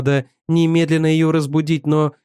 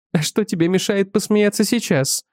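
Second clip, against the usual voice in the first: wife's voice; 6 semitones higher than usual. A narrator's reading. Wife voiced high.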